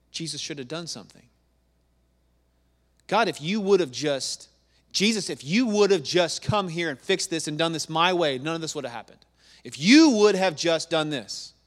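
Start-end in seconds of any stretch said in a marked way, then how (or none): 3.1-11.5 loud, indignant acted complaint; entitled reproach. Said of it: "she feels entitled".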